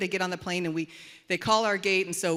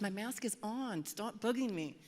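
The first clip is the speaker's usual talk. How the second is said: soft, slow, irritated mutter; wife to the attendant, a soft annoyed mutter; 9 dB quieter, slower than usual. Retold or imitated. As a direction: grumble it under your breath. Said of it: "I said".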